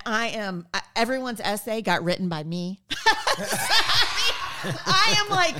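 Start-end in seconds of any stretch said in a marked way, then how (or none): 2.8-4.6 high, loud burst of laughter; a bright, loud laughing fit; real laugh. Real, not acted. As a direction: crack up for real. Laughing at her own story.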